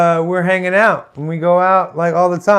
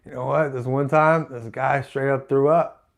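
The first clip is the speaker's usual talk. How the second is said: low, soft, offhand anecdote; imagined retelling; 4 semitones lower, 7 dB quieter than usual. Retold or imitated. Masseuse someday telling the story, low and offhand. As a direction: tell it quietly, like a casual confidence.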